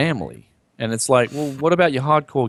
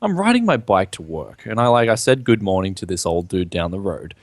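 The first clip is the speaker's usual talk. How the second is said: ordinary delivery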